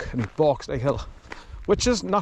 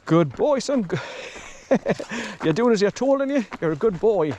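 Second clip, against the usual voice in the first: dog praise; 6 semitones higher than usual. Real, not acted.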